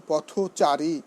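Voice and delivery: পথচারী slowly as potho-chari, linking o sounded; correct pronunciation. Shown as a demonstration. Teacher giving an example of compound words, correct pronunciation. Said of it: "ঔ ব্যবহার করি".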